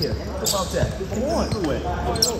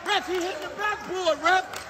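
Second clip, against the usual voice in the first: yelling across the court; 9 semitones higher, slower than usual. Real, not acted. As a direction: shout it across a noisy gym. Coach yelling during game at ref.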